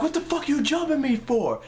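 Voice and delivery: high-pitched outraged demand; indignant yelling. Retold or imitated. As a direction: act out confronting your attackers. Acted yelling at his attackers.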